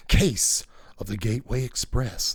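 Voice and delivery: low, dramatic podcast announcer; podcast intro voice. Shown as a demonstration. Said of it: "the intro to a podcast or something".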